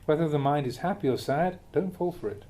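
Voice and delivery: ordinary talk, nothing marked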